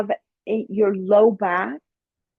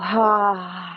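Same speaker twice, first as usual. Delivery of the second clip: release sigh. Shown as a demonstration. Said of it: "And let it go".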